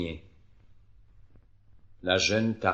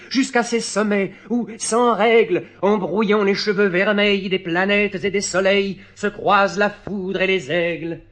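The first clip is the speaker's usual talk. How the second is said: higher, impassioned verse recital; pleading clown; 10 semitones higher, 5 dB louder than usual. Acted. Clown begging his springboard to lift him higher, impassioned plea. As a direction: implore with mounting fervour.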